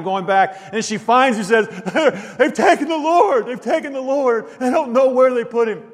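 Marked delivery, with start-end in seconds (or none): 2.4-5.8 distraught crying plea. Retold or imitated. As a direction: cry it out like a heartbroken mourner who lost everything. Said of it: "imagine her just crying".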